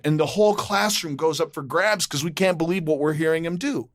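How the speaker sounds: usual speaking voice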